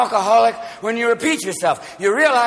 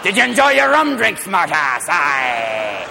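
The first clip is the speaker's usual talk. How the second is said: taunting sailor; 2 semitones lower, slower than usual. Acted. Sailor taunting the drunk recruit.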